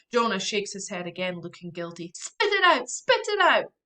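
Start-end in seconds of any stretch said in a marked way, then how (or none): 2.1-3.7 high, loud urgent yell; panicked sister yelling at her brother. Acted. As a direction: order him to spit it out now. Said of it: "I yell".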